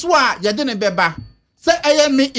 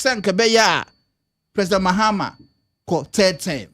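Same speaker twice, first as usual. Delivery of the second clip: normal talking voice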